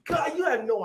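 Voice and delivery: high, slow swooning gush. Real, not acted. To friends teasing him about the bartender, swooning.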